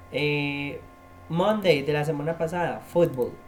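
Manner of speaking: normal talking voice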